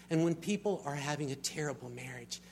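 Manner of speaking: ordinary delivery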